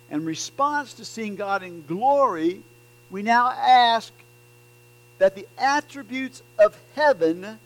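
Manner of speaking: preaching at full force. Acted. Preacher urging the congregation to trust God, loud and driving. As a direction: press the point home to the congregation.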